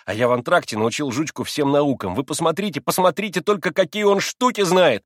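high, loud, excited explaining; eager boasting. A narrator's reading. Boy showing off the trained dog, eager and excited. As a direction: tell it all proudly and urgently.

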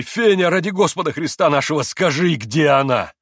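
loud, high pleading cry; loud desperate plea. A narrator's reading. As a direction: beg her on your knees. Mitya begs on knees.

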